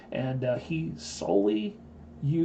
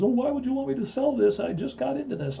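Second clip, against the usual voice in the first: much higher, louder, startled protest; imitated surprise; 13 semitones higher, 5 dB louder than usual. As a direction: mimic a shocked friend. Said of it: "He goes".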